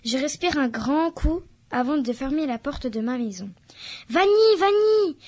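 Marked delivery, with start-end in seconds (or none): 4.1-5.2 a long, high, loud call down the street; called out from afar. Acted. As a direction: shout the name slowly, as if from the end of the road. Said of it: "On hurle mon prénom". (appel crié de loin, fort et lent)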